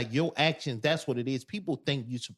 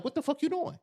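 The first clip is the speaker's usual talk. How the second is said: high, sharp mock outrage at a stranger; shocked what are you doing; startled outrage; 13 semitones higher than usual. Telling how he'd react to a stranger in the booth, high startled outrage. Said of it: "so I'm like".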